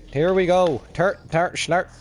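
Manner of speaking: high, loud mock Irish accent. Shown as a demonstration. Player showing off his accents to co-host, mock Irish. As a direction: put on a hammed-up Irish accent. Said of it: "This is Irish".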